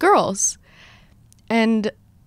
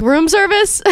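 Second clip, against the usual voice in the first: surprised; 9 semitones higher than usual. Retold or imitated. Realizing the card mix-up.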